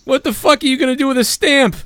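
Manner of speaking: high, mocking voice; mocking Bailey. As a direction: whine it in a high put-on voice. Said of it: "Bailey fucking goes".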